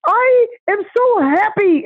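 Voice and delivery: very high, loud and slow joyful cry; woman leaving the salon overjoyed. Retold or imitated. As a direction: sing it out with pure joy. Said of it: "she just went out the door going".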